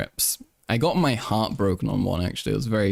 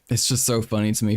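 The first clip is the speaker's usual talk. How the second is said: usual speaking voice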